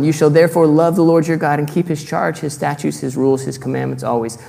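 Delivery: solemn public reading of scripture. Acted. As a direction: read the verse out like a proclamation. Reads Deuteronomy aloud.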